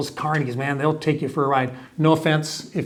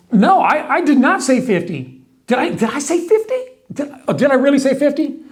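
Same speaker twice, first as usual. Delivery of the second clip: mock shocked salesman; 9 semitones higher than usual. Salesman fakes shocked denial.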